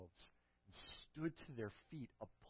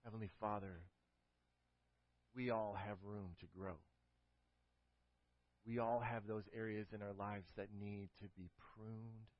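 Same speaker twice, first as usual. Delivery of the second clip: closing prayer; 2 semitones lower, 4 dB quieter than usual. Acted. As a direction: pray it gently, slowing toward the end.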